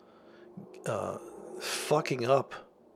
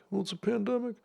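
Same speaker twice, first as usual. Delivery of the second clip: mock alarmed voice; 10 semitones higher, faster than usual. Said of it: "Then the movie Pandemic".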